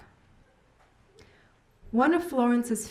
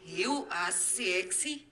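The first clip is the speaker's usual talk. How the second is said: backwards speech; 6 dB quieter, much slower than usual. Shown as a demonstration.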